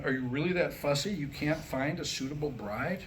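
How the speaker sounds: normal talking voice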